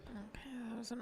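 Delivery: whispered play-by-play; whispering. Real, not acted. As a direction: whisper the call courtside. Whispered commentary during play.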